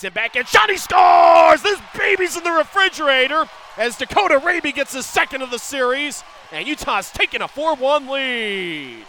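very high, loud goal shout; goal call shout. Real, not acted. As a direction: explode on the goal. Goal call in hockey broadcast.

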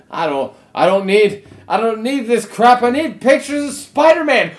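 high, building angry yell; hammed-up boss blowing his top; J. Jonah Jameson. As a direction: rant that climbs to a full shout. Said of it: "Jonah Jameson yelling at drummers".

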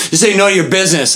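snapping back. Retold or imitated. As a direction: tell the nosy stranger to back off. Stranger snaps at narrator.

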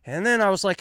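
normal talking voice

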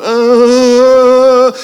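high, loud strangled moan; scared moan. Shown as a demonstration. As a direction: moan in real terror. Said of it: "more like a moan".

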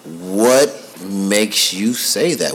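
very slow, drawn-out "What makes you say that?"; slow probing question. Retold or imitated. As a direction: stretch each word out, asking his son. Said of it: "so I'm like".

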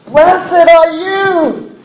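long, high, loud cry of joy; joyful outburst. Acted. Preacher voices Elizabeth filled with joy, shouting it out. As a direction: blurt it out, stretched and bursting with joy. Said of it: "she blurted out".

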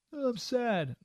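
mockingly wallow in being sad; mock self-pity.

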